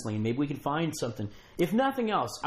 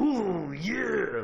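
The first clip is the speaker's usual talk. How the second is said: gravelly, shaky wrestler growl, pitched up; Randy Savage; 6 semitones higher than usual. Retold or imitated. Showing guest the wrestler's catchphrase, shaky gravelly impression. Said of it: "this gravelly voice".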